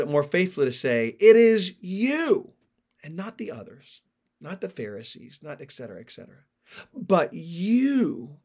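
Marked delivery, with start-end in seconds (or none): 1.2-2.5 YOU pushed high, loud and long; raised, drawn-out punch on "you"; emphatic YOU. Shown as a demonstration. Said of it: "emphaticness of the you here". 3.0-6.4 hushed, dropped-away parenthesis; much softer, brushing the others aside; quiet aside. Shown as a demonstration. Dismissive aside inside emphatic rendering. 6.9-8.4 "you" raised and drawn out; emphatic YOU. Shown as a demonstration. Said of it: "emphaticness of the you here".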